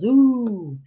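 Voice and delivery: high, falling "ooh" of wonder; long awed ooh; crowd's awed ooh. A narrator's reading. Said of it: "everybody goes".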